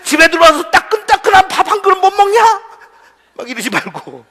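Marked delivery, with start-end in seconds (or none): 0.0-2.4 loud, high complaining husband; mock angry husband. Husband scolding his wife over dinner, loud mock anger. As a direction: bellow it like a grumpy husband home from work.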